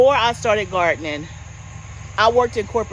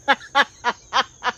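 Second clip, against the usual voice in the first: real laugh; 3 semitones higher than usual. Real, not acted. Laughing at daughter's question.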